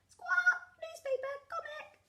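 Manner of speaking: very high squawking parrot voice; parrot mimicry. A narrator's reading. Parrot copies the dogs' shopping order, very high squawky mimicry. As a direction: parrot back the words in squawks. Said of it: "Polly Parrot copied".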